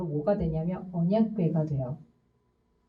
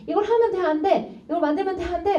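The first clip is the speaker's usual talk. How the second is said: mock scolding; 9 semitones higher than usual. Mother scolding her little son, imitated high and sharp. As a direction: replay telling off the kid, pitched way up.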